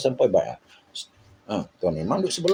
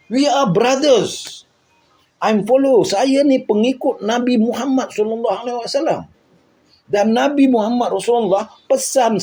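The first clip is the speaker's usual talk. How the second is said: higher, earnest preaching to the crowd; impassioned speech; 7 semitones higher than usual. Retold or imitated. Retelling his plea to the armed crowd, impassioned.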